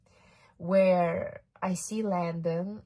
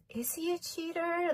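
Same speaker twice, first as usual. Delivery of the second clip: much higher, softer question in disbelief; incredulous wondering; 11 semitones higher, 5 dB quieter than usual. Retold or imitated. To herself, doubting the chart about her ex, high incredulous. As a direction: gasp it out as if shocked by the idea.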